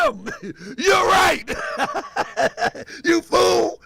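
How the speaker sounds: breaking into laughter mid-reply; giggling while agreeing, pitch up; laughing. Real, not acted. Host laughing at a viewer calling him out.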